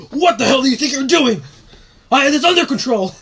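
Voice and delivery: high, loud yelling in character; angry police officer. Acted. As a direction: bawl them out as a furious cop. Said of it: "Just yelling".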